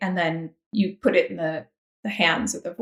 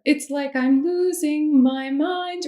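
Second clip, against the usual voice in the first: slow, much higher, lingering on "losing"; drawn-out rubato; 6 semitones higher, slower than usual. Shown as a demonstration. Imitating singers who take their time on the line, slow and high. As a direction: stretch out "losing" as if the thought just struck you. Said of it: "take a little bit more time on the words".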